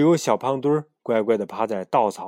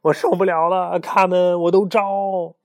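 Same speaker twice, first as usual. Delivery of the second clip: breaking down; 8 semitones higher, 8 dB louder than usual. Acted. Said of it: "精神快要崩溃了".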